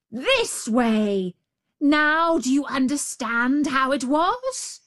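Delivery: furious storybook tiger; tiger losing patience. A narrator's reading. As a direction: shout it impatiently as the tiger. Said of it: "the tiger lost patience".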